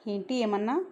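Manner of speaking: ordinary delivery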